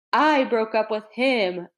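usual speaking voice

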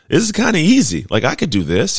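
high, loud exclaim of easy relief; a retold burst of happy surprise; mock amazed relief. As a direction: sound delighted it's suddenly easy. Retold relief after tough coach.

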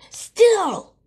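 shouted high and loud; loud high yell. Acted. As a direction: belt the word still. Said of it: "but still".

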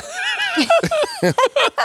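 a high, open burst of real laughter. Real, not acted. Laughing hard at the Craigslist joke, high burst of laughter.

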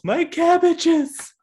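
high, slow, anguished wail; cabbage merchant yell. Retold or imitated. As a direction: wail over lost cabbages. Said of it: "and he yells".

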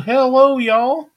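high, loud mock yell of a kid's greeting; bright shouted hello to the whole chat; relayed yell. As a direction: holler it out the way the girl did. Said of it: "Gabby yelled".

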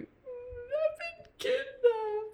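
put-on very high, slow little-girl voice; fake helpless girl. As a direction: whimper it like a fake victim. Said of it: "she puts on the persona of".

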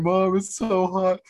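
put-on high, slow, whiny little-boy wail; imitating crying kid. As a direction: mimic a kid in pain, pitched high and drawn out. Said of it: "And he starts crying".

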